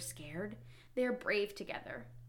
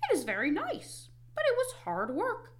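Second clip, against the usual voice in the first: high-pitched, lilting frog; high storybook Frog; Frog character voice; 12 semitones higher, 3 dB louder than usual. A narrator's reading. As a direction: answer proudly in Frog's high voice. Frog's voice in read-aloud story.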